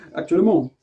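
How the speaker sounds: laughing at her own silly mouth pose; laughing at herself. Real, not acted. Teacher laughing at looking ridiculous while demonstrating. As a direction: chuckle through the self-mockery. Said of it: "hyper ridicule un peu comme je le suis".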